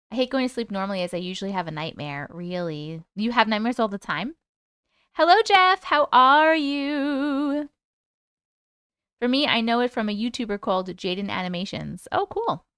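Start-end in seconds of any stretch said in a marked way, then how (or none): 5.1-7.7 high sing-song greeting. Real, not acted. Greeting a chat viewer.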